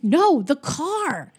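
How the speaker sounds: high, drawn-out exasperated retort; mock yelling. Host retells son warning dad about rolling car, mock exasperated yell. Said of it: "still yelling at each other".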